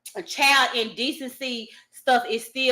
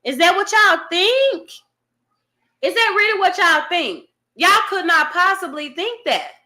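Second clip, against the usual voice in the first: incredulous challenge; 6 semitones higher than usual. Real, not acted. Challenging viewers.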